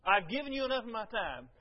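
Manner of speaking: hammed-up put-upon tone; annoying way. Pastor paraphrasing his rude tone to Lisa, mock impatient. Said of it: "in your own annoying way".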